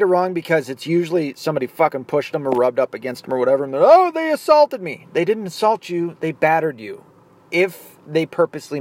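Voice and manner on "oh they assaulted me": high, loud whiny mockery of a complainer; mock victim whine, pitched way up; mocking whiny quote. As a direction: sneer it like a crybaby. Mocking people who misuse assault.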